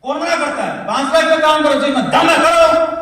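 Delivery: high, loud motivational push; rousing challenge. Real, not acted. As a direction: challenge the crowd at full voice. Rousing crowd to aim higher. (जोश से ललकारते हुए)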